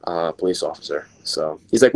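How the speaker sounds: ordinary talk, nothing marked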